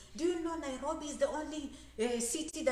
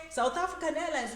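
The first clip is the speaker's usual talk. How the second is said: normal talking voice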